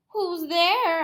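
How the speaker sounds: high, loud fake granny call; wolf faking Granny. Wolf in Granny's bed answering the door, high.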